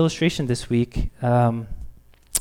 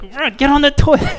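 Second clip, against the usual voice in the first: higher, much louder urgent order; frantic yell; 13 semitones higher, 10 dB louder than usual. Retold or imitated. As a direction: hurry her with a shout. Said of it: "kinda yelling at her".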